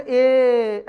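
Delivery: "ए" held out on one steady note; sustained, stretched "ए"; long vowel. Shown as a demonstration. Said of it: "लंबा स्वर के साथ अगर बोलने".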